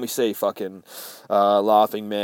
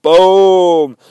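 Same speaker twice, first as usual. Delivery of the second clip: loud boom; 7 semitones higher, 13 dB louder than usual. Acted. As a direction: belt out a big boom. Opening shout of a comic review.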